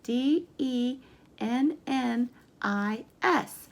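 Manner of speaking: ordinary talk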